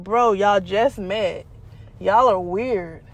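flatly unbothered put-down; telling the boyfriend she doesn't care; unimpressed. Retold or imitated. Unimpressed by the three-way call.

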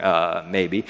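low, reluctant retold admission; hesitant stammer. The woman answers David's question, hesitant mock stammer. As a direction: stall on a drawn-out uh, imitating her. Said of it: "she stutters and is like".

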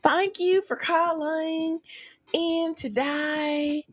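slow, high, drawn-out Southern drawl; Southern accent. Shown as a demonstration. Friend asked for Southern, thanking caller, Southern accent. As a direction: stretch vowels in a sweet Southern accent. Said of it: "do a Southern accent".

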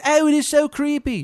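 loud, high, exaggerated Maryland twang; terrible Maryland accent. Baba Yaga greeting a newcomer, bad Maryland accent. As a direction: holler in a hammed-up Maryland accent. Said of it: "Accent's terrible".

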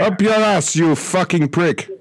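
high mocking shout; angry shout. Caller shouts insult.

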